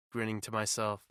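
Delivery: plain reading voice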